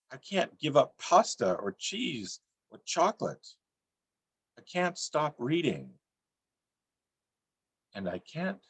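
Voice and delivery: rhythmic list-poem recitation; poem reading. Acted. Poet reciting his list poem to the room, rhythmic. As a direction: chant each I can't as a steady refrain.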